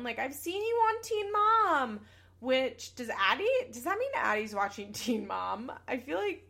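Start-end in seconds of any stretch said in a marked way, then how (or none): 0.2-2.0 high, drawn-out mock yell; imitated yell. Child yelling at the phone at a reality star, mock yell. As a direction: call it out high and loud, slowly. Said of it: "like, yelling at the phone".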